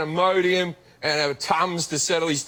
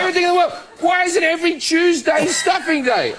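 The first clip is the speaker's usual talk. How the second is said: much higher and louder, shouting the phrase; mock outraged shout; 11 semitones higher, 4 dB louder than usual. Comedian shouts about stuffing to a talk show host, mock outraged. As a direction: yell it back in comic fury.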